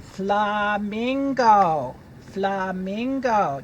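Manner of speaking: very slow, drawn-out naming; teacher modelling a new word; slow model word. Shown as a demonstration. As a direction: stretch each syllable for the children.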